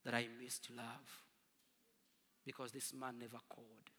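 quiet realization. Retold or imitated.

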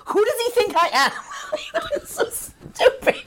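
high, squeaky laughing disbelief; breaking up with laughter. Real, not acted. Laughing at the plot.

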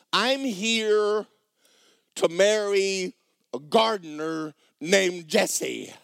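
overwhelmed and pleading; flustered protest. Acted. Bewildered girl to the castle staff, high flustered pleading.

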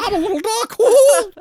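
high, loud silly puppet voice; little doggy voice. Pretend. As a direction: play a goofy hand-puppet dog, laughing. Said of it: "I'm gonna make a little doggy".

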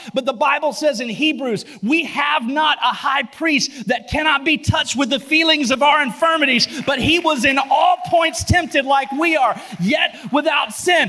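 loud, high, building sermon cry; preaching at full force. Acted.

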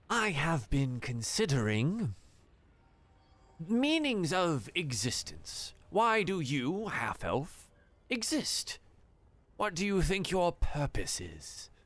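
slow, musing bird-man; philosophical creature voice. Acted. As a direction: ponder it like an ancient thinker. Creature asks deep question.

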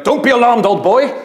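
mock-theatrical Brian Blessed, brushing off a bruised face; booming Brian Blessed. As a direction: boom it out like a jolly grand thespian. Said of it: "And he, and he went".

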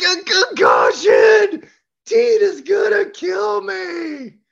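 high, loud, slow panicked cry; panicked shout. Retold or imitated. Coach hears of the concussion, dreading Tita, a high slow wail.

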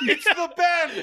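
high, drawn-out mock scream; mock panic. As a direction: shout it like a hammed-up horror victim. Mock panic at masked Ben.

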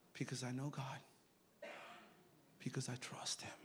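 hushed, low reply, nearly a whisper; quiet assurance. Retold or imitated. Answering the alarmed friend about trusting God, hushed assurance. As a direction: drop right down, soft and low.

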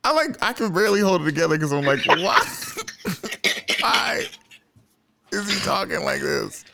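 high, laughing, barely holding it together; words squeezed out between laughs; laughing through it. Real, not acted. Host cracking up retelling friend's servant act.

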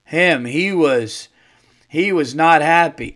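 loud, slow, emphatic; imitating staff lady. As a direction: drag out "him" slowly. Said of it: "She goes".

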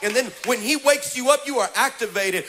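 loud, rhythmic preaching cadence; preaching at full force. Acted. Pastor rousing the congregation to wake up, preaching loudly. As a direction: drive each line home to the crowd.

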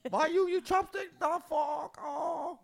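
very high, wailing mock foreign caricature; Kruger impression. Mocking Marty as a co-host would, high wailing impression. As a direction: wail it out in a shrieky, drawn-out put-on voice. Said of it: "It's what Kruger would have done".

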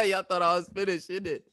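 hammed-up London accent through laughter; laughing British accent. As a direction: cackle through a cheeky British tease.

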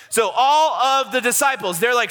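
ordinary talk

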